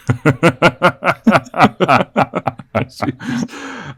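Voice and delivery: long, loud high-pitched laugh; big rolling laugh; hearty laugh. Acted. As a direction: burst out laughing and keep it going. Laughs at the stranger's joke.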